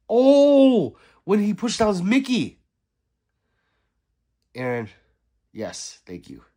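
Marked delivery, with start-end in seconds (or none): 0.1-2.6 loud, high burst of recognition; louder and higher, sudden realization; excited recall. Real, not acted. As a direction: blurt it out as it comes back. Suddenly remembering the scene.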